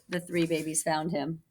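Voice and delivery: ordinary delivery, nothing marked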